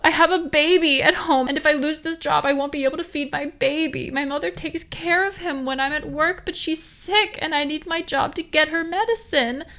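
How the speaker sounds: high, loud, hammed-up sobbing; a hammed-up sobbing driver pleading with the guard; sobbing plea. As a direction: sob out a fake sob story. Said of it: "The G Z began to sob".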